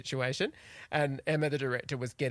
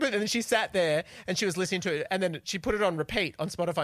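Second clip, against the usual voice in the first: usual speaking voice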